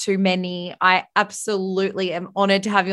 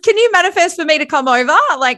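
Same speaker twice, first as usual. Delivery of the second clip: excited; 6 semitones higher, 6 dB louder than usual. Real, not acted. Excited about a New Zealand trip.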